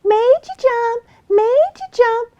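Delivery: high, slow sing-song squeal of a little fox; gleeful squeaky fox teasing; squealing taunt. Acted. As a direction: tease him with a squealing chant.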